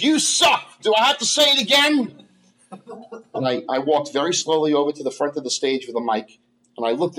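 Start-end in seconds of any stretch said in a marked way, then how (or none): 0.0-2.2 heckler shouting. Retold or imitated. Heckler retold in comedy-club story.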